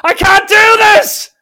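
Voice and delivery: high-pitched, drawn-out full scream; top-of-voice scream. Retold or imitated. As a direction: scream it out at the top of your lungs. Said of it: "screams at the top of his voice".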